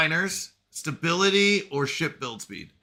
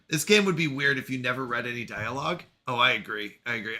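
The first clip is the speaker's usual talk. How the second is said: ordinary talk, nothing marked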